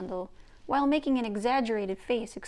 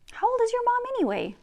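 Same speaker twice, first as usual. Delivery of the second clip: put-on much higher, louder, fast girl's question; eager Gidgee; 14 semitones higher, 6 dB louder, faster than usual. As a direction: blurt it out, bursting with curiosity.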